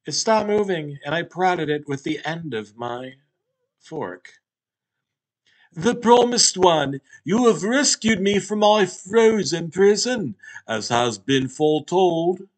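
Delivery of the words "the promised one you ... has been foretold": grave talking Hot Pocket. Acted. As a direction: proclaim it like an ancient oracle. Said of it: "It was a man's voice with hints of an English accent".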